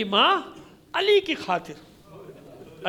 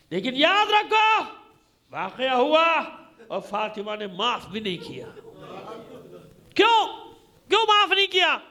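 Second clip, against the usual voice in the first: impassioned preaching; 6 semitones higher than usual. Real, not acted. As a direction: drive the point home to the congregation. Preacher builds to full force.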